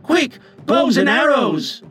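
high, urgent chorus; high-pitched rallying shout; urgent boys' cry. A narrator's reading. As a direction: call everyone to arms at once. Boys rush to shoot.

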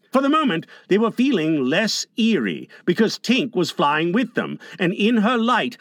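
plain reading voice

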